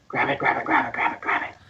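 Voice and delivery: hushed, repeated urging as kobolds; acted conspiratorial whisper; urgent whispering. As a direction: whisper it over and over, egging someone on. GM voices kobolds urging the human.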